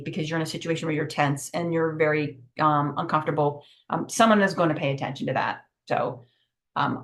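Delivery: ordinary talk, nothing marked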